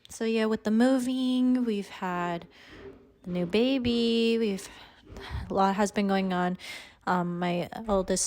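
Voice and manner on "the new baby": usual speaking voice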